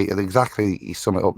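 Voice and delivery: ordinary delivery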